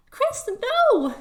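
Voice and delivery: very high alarmed cry; urgent call from a little way off; distant yell. Acted. Someone yells to stop Chris, high and alarmed. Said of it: "you hear a yell from a".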